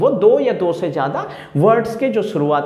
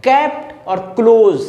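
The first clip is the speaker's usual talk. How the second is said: Kept and close slow and a little louder; stress on the k sound; 4 dB louder, much slower than usual. Shown as a demonstration. Teacher slowly stressing the shared k sound. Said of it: "दोनों जगह sound same है".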